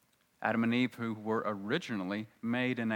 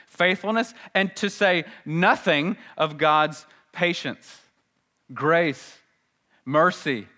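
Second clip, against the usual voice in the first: raised, emphatic pulpit delivery; fervent preaching; 6 semitones higher, 10 dB louder than usual. Real, not acted. Preacher extols God's attributes to congregation, fervent preaching. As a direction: list them with mounting force.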